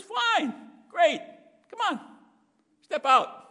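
high, loud cheerful invitation; God urging Peter to step out onto the water, loud and upbeat; God inviting Peter. Acted. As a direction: call him out of the boat brightly. God answers Peter, voiced.

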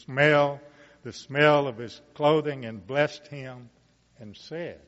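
measured, rising Bible reading voice; scripture reading. Acted. Preacher reading Genesis aloud to the congregation, solemn and building. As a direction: read the blessing to the congregation, building.

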